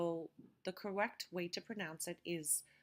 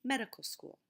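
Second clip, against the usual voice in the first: "medical" punched higher at the start, then falling; stress on MEDICAL; 5 semitones higher, 4 dB louder than usual. Shown as a demonstration. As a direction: hit the first syllable of "medical", let "school" drop. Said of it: "and I mark the stress".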